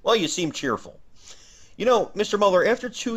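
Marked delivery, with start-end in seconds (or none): none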